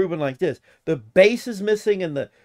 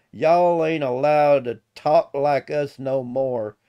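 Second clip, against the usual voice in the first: slow, drawn-out Southern mock decree; founding fathers' Southern talk; 3 dB louder, slower than usual. Founding fathers ordering the king, slow mock-Southern drawl. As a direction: lay down the law in a drawling Southern voice. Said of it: "our founding fathers told the king".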